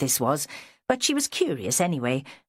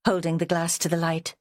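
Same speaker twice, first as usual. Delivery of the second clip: usual speaking voice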